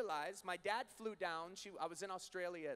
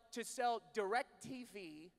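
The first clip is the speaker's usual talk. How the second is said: normal talking voice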